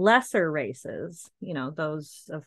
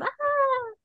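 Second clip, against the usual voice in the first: high startled cry. Acted.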